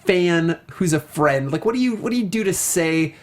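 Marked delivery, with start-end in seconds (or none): none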